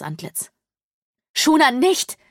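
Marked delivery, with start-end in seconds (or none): 1.3-2.2 high, loud urgent cry; pleading call. A narrator's reading. Mother holding back the maid, crying out in alarm. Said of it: "wiederholte Mutter".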